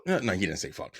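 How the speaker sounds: normal talking voice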